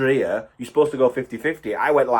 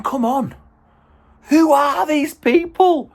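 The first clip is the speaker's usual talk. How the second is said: high, slow disbelieving cry; exasperated; 4 dB louder, slower than usual. Real, not acted. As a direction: throw your hands up in disbelief. Exasperated rant at time-wasters.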